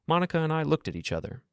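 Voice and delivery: ordinary delivery, nothing marked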